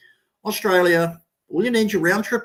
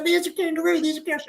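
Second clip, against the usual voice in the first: high, thrilled tourist squeal; excited tourist; 11 semitones higher, faster than usual. Retold or imitated. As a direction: imitate a thrilled tourist pointing. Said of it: "they are just so ecstatic".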